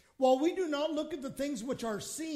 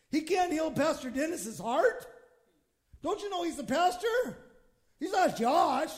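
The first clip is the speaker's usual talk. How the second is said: higher, louder mock protest to God; indignant questioning; 5 semitones higher, 6 dB louder than usual. Church member challenges God about the sick pastor, mock indignant. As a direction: build to an incredulous shout.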